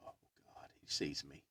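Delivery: hushed, voiceless mock dread; retold panicky whisper under the breath; dismayed whisper. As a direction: whisper it, imitating someone just caught. Retold dread at being spotted.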